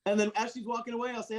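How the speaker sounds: normal talking voice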